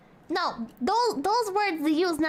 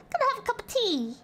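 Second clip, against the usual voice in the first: high-pitched mock English accent; badly faked English tea-drinker; 7 semitones higher than usual. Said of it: "worst English accent I've ever heard".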